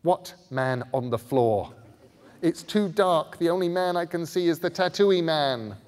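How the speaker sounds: high, loud, exasperated; irritated girl. A narrator's reading. Scarlet snapping at Bod in the dark, loud and exasperated. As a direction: snap it impatiently. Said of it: "Scarlet sounded irritated now".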